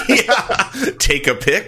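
says it through a laugh; breaking into laughter mid-phrase. Real, not acted. Laughing at the long list.

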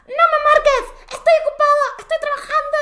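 loud, high-pitched exasperated shout; full-volume reenacted outburst; angry dad yelling. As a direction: imitate a fed-up dad shouting into the phone. Acted father ending call angrily.

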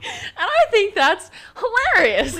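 amused pride. Real, not acted. Proud of own joke.

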